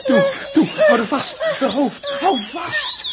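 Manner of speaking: high, loud panicked commands; frantic shout. Acted. Crew member holding down convulsing Els, frantic shout. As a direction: yell over the chaos. Chaotic struggle during convulsions.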